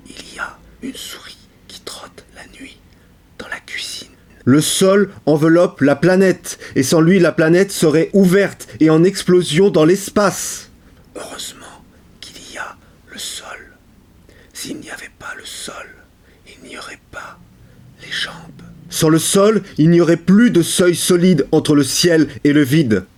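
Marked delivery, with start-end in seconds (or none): 0.1-4.1 very soft, unvoiced whisper. Alone at night with a mouse in the kitchen, whispered. 11.2-18.3 hushed whisper with long pauses; whispered poem. Acted. Speaker privately thankful for the ground, whispered.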